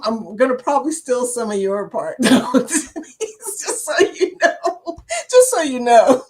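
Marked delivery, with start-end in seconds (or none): 2.2-5.0 giggling through the words; laughing. Real, not acted. Teasing about stealing logo. 5.3-6.2 teasing. Real, not acted. Repeats tease with emphasis.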